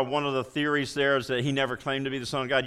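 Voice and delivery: ordinary talk, nothing marked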